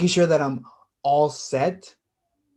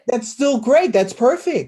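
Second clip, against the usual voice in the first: higher, eager insistence; excited; 7 semitones higher, 4 dB louder than usual. Acted. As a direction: keep cheering her on. Brother cheering sister's news.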